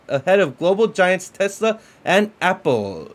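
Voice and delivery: upbeat headline read to viewers; news read. Acted. Host reads the Bitcoin headline to his viewers, news style. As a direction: read it like a crypto news anchor.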